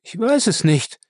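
high-pitched, defiant moan; defiant groan. A narrator's reading. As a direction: groan it out stubbornly, pitched well up. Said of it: "stöhnte Lacey trotzig".

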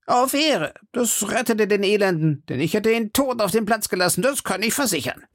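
very high, boastful musketeer voice; Porthos. Acted. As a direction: brag of a duel, pitched far up. Porthos boasts of his duel.

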